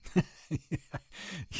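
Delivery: breathy burst of a high laugh, no voice; amused laugh. Acted. Amused at the youngster's wish, a quick airy laugh. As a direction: laugh off the idea.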